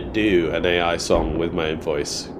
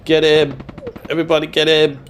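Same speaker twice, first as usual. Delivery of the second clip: shouting; 9 semitones higher, 5 dB louder than usual. Real, not acted. Calling out enemy in game.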